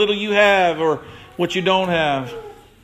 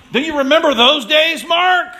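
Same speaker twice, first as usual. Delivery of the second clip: excited reminder; 9 semitones higher, 5 dB louder than usual. Retold or imitated.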